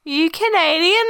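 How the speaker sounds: put-on high, curious kid's question; kids asking. Teacher recalls a student asking if she's Canadian, high and curious. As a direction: ask like a curious schoolkid. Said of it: "they will often ask me".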